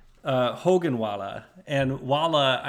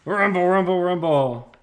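loud, higher rolling rumble; rumble sound effect; 4 semitones higher, 7 dB louder than usual. Acted. Cutting into the comic dialogue, loud rumble sound effect. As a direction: call out the rumble like a noise. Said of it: "then they hear rumble".

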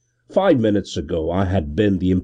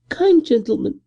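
high, thin beggar woman's jibe; a ragged woman's thin sneer; high thin bitter whine. A narrator's reading.

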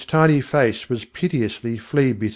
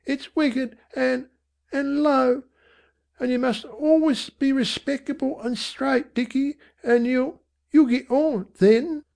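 high, halting, pleading voice; tearful mother; 13 semitones higher than usual. A narrator's reading. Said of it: "with tears in her slack eyes".

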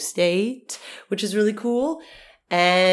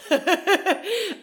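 giggle; 12 semitones higher than usual. Real, not acted. Laughing about being in thirties.

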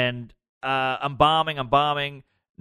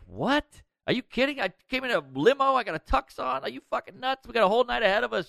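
high-pitched, stunned disbelief; incredulous protest; 9 semitones higher, faster than usual. Retold or imitated. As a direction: act out an outraged "are you serious" plea. Retold protest at being sent home.

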